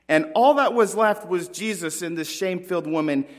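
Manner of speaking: usual speaking voice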